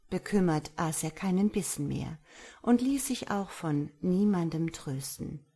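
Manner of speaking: usual speaking voice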